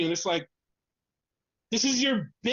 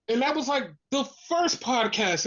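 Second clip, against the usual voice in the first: ordinary talk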